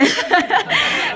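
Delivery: loud, high burst of laughter; hearty laugh. Real, not acted. Speaker reacts to audience saying it's a fact, laughing loudly. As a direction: crack up out loud, pitch shooting up.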